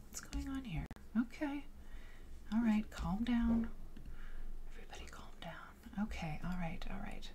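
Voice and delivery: near-whispered, very soft muttering; hushed self-soothing. Real, not acted. Streamer settling a technical hiccup, hushed. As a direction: talk the chaos down under the breath.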